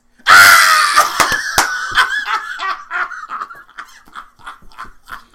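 loud, high belly laugh that trails on; long real cackling laugh; uproarious laughter. Real, not acted. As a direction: crack up at your own punchline. Laughs hard at own punchline.

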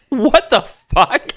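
mock-stunned, stretched-out reaction; stunned disbelief. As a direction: draw it out, aghast at the excuse. Stunned reaction to slavery excuse.

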